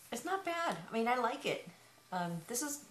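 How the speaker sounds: muffled by cloth over mouth; speaking behind a balaclava; through a face covering. Real, not acted.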